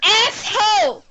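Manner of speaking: high, slow mock yell of "Asshole!"; imitated yelling at TV. She imitates yelling at a show character, high and drawn out. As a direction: scold a TV character in mock outrage. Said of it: "I was just yelling at him".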